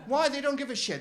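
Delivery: usual speaking voice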